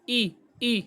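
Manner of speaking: ای clean and straight out; plain open ای; straight sound. Shown as a demonstration. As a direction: clear glottal ای demo. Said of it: "حمزہ کی آواز ای".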